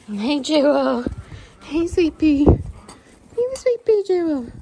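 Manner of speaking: high, slow sing-song coo. Real, not acted. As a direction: coo at a beloved pet.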